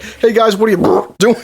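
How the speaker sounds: imitating a man who burps as he talks; burping mid-sentence. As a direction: let a fake belch interrupt the sentence. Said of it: "he burps mid-sentence".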